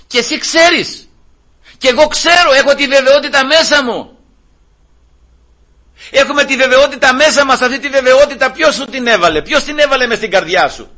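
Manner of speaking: high, emphatic preaching voice; fervent preaching. Real, not acted. Preacher assuring believers of their certainty, fervent preaching. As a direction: declare it to the congregation with conviction.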